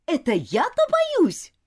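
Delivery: high, loud, slow indignant cry; shouting. A narrator's reading. Girl denies being scared to governess, shouting. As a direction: shout back offended. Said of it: "закричала она".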